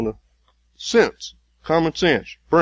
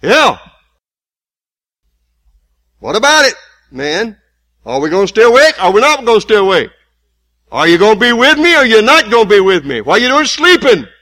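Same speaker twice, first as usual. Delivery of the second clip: loud, high, rough demand; Jesus voiced as an angry friend shouting at sleeping Peter; scolding shout; 9 semitones higher, 13 dB louder than usual. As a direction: shout the friend awake, fed up. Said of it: "Jesus didn't say it in that tone of voice".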